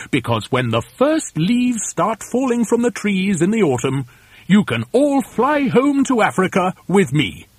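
a hint of chirpy storybook bird; lightly voiced reassuring bird. A narrator's reading. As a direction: offer the solution with lilting swoops.

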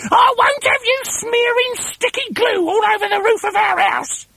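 very high, screeching wife; scolding Missus Twit. Acted. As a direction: scold your husband in a piercing put-on voice. Missus Twit scolding her husband.

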